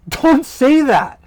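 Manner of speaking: high, loud, slow Don't say that, with a laugh; laughing shout. Shown as a demonstration. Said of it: "best English equivalents".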